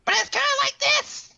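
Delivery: very high, squeaky cartoon mascot voice; Teddy's Japanese voice. Mocking the Japanese dub of the bear, very high and squeaky. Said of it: "high-pitched and very rough".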